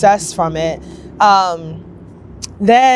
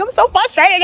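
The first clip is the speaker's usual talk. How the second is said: whiny frustration; 11 semitones higher than usual. Retold or imitated. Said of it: "I was, like, about to cry".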